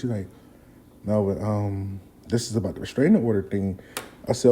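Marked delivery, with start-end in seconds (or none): 1.1-3.8 soft, low, hesitant admission; mildly nervous. Retold or imitated. Nervous friend explaining the court date, soft and low. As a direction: imitate a worried friend, hushed and low. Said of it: "I'm kinda nervous".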